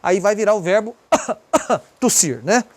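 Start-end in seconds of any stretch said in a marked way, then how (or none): 1.0-1.8 demonstrative cough. Shown as a demonstration. Teacher acting out the verb tossir, pretend cough. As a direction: fake double cough.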